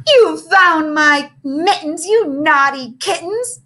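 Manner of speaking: slow, high, loud purr. Acted. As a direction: purr it out slowly and teasingly. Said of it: "the librarian purred".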